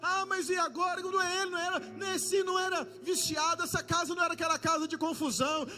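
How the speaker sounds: loud, driving sermon build with shouted peaks; preaching at full force. Acted. Preacher stirring the church about neighbors, full force. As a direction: hammer each line harder to the congregation.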